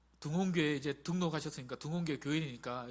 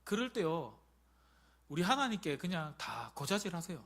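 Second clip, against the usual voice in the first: ordinary talk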